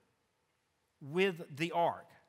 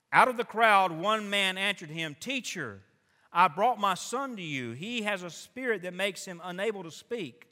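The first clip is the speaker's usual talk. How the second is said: loud, high preacher's reading; loud, high, fervent sermon reading; preaching at full force; 4 semitones higher, 6 dB louder than usual. Acted. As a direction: drive the scripture out with full pulpit force.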